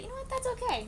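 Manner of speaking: very high, squeaky reassurance; mock-chipper falsetto; playful high voice. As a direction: jump way up in pitch, playfully forgiving. Brushes off the late show start.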